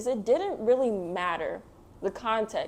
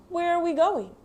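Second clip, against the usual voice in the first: higher, lightly voiced little Oscar; curious child character; 9 semitones higher, 5 dB louder than usual. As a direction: ask it like a curious kid.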